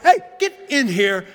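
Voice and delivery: retold angry call to the kids; steamed dad. Steamed dad calling his laughing kids in, angry. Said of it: "I'm getting really steamed".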